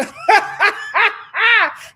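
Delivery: high loud laughter. Real, not acted. Host still laughing at the mother's reply. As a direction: keep laughing.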